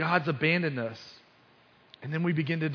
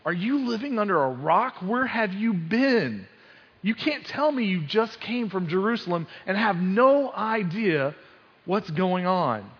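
higher, disbelieving jab, as if to someone living under a rock; mock disbelief, pitched up throughout; incredulous; 6 semitones higher, 4 dB louder than usual. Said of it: "It's almost like he's saying to Jesus".

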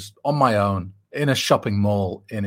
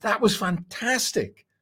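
mock applause; 9 semitones higher, slower than usual. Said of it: "wanted to applaud and say".

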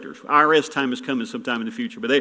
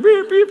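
high mock beeping; 10 dB louder than usual. Imitating the car key fob.

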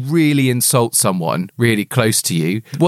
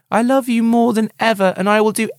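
higher, lightly voiced tender devotion; Mary's voice; 8 semitones higher than usual. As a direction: read it as a devoted, gentle friend. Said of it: "This is a quote from Mary".

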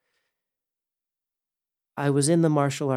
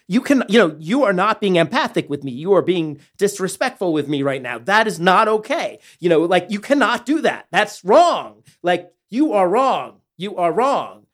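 intense, louder, higher-pitched scolding; escalated confrontation; 8 semitones higher, 8 dB louder than usual. Retold or imitated. Telling the studio owner he is disrespectful, escalated and intense. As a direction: get in his face and lay down the law. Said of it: "I escalated".

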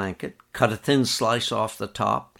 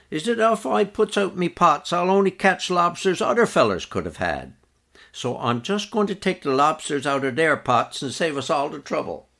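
Harvey voiced; 7 semitones higher than usual. A narrator's reading. Harvey's dialect quote.

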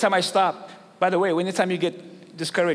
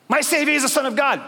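eager believer; 9 semitones higher, 3 dB louder than usual. Acted. Acted believer versus Caesar follower.

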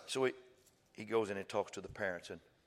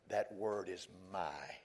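slow hushed; much slower than usual. Acted. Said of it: "And said".

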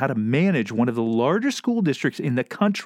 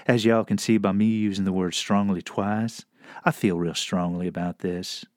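softened southern voice; 5 semitones lower than usual. Acted. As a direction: state firm objections in a gentle, lower Southern accent. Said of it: "might have come off a little softer".

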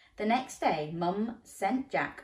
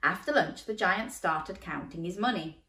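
ordinary delivery, nothing marked

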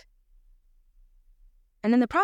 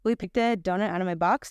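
ordinary talk, nothing marked

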